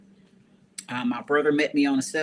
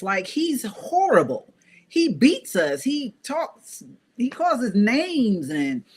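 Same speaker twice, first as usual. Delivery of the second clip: much higher, heated retold protest; pitched-up indignant pleading; angry outrage; 7 semitones higher than usual. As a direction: rant like the furious thirteen-year-old. Retelling rage at mom stopping her.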